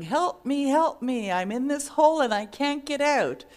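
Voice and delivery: loud, high cry for help; yelling for help. Acted. Man stuck in a hole yells for help. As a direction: shout up from the bottom of a hole. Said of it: "And he's yelling".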